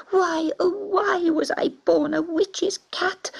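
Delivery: high, tearful wail. A narrator's reading. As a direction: cry out in despair. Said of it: "cowering in his cage".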